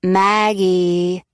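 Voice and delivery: lilting sing-song call of a name. Acted. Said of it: "Nola says in a sing-song voice".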